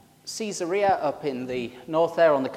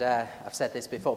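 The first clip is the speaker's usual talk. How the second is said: ordinary talk, nothing marked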